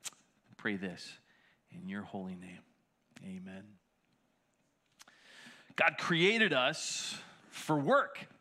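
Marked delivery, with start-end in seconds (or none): 0.1-3.6 low, hushed prayer voice; quiet prayer. Acted. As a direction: pray softly and reverently. Closing prayer.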